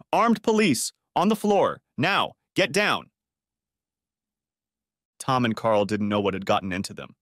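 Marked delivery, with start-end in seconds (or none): 0.1-3.1 barked police commands, much higher; yelling. Acted. Armed police bursting in on the boys, yelling. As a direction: storm the room shouting orders. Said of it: "They were yelling".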